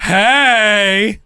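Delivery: lightly voiced Wendy Williams "Hey!"; Wendy Williams impression. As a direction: shout it like a talk show host. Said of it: "That's what she says on the Wendy Williams show".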